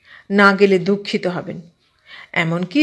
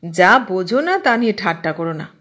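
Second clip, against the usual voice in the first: usual speaking voice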